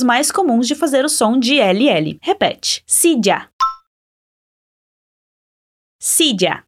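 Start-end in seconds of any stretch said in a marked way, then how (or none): 2.9-3.5 "Silla" with LL close to DJ; Spanish silla. Shown as a demonstration. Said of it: "você pode imitar minha pronúncia". 6.0-6.6 "Silla" with LL close to DJ; Spanish silla. Shown as a demonstration. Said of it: "você pode imitar minha pronúncia".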